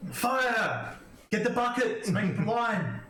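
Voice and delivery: rally a bucket brigade, in character; play-acted shouted orders; acted fire alarm. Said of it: "the swords come up and go".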